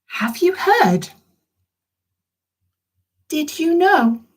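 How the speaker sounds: high, slow storybook voice; reading to children. Acted.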